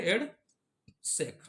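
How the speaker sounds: EDSAC stretched very slowly and falling; slow emphatic EDSAC for the class; drawn out. Shown as a demonstration. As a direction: dictate EDSAC slowly, syllable by syllable. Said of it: "किस में पहुंच जाते हैं?".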